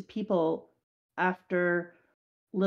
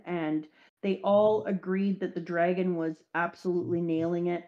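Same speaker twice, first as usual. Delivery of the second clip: ordinary delivery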